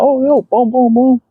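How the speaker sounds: high, hyped, sound-effect delivery; excited. Retold or imitated. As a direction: hype it up like a breakaway. Retelling running with ball.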